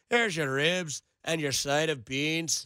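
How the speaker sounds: put-on slow Birmingham gangster accent serving the table; Peaky Blinders accent. Waiter to a random table, in a slow Peaky Blinders accent. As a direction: drop the food off slowly in a Brummie gangster voice. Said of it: "talking in that accent all the time".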